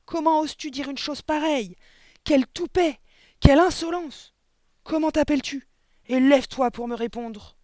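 high, loud indignant tirade; furious scolding. A narrator's reading. Headmistress orders a cheeky pupil to stand, furious scolding. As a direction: bellow each phrase at the insolent child.

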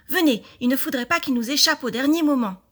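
loud, high rallying call; urgent exclamation. A narrator's reading. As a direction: urge the friends to come at once. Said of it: "s'écria Adèle".

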